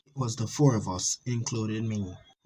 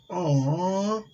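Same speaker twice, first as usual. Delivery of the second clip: mock sweet aw; 9 semitones higher than usual. Girlfriend voiced in horror story.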